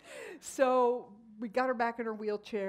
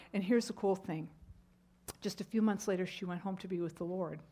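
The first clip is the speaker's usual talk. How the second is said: normal talking voice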